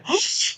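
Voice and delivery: sharp, theatrical intake of breath; mock shocked gasp. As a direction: gasp as if stunned by the obvious. Feigns shock at obvious fact.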